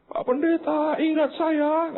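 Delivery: very high, pleading mock cry; imitated tearful wailing. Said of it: "Dia teriak nangis keras". (menirukan tangisan ibu yang memelas)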